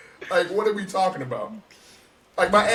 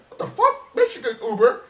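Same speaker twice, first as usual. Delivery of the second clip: high-pitched, put-on disbelief; mock incredulous outburst; 7 semitones higher, 4 dB louder than usual. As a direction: act out a friend's baffled protest. Acted incredulous reaction from friends.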